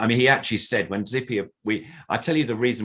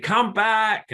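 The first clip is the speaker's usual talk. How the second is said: high, louder mock call to come back; imitating a host calling out, much higher; 6 dB louder than usual. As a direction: call him back across the stage. Adam calls him back on stage.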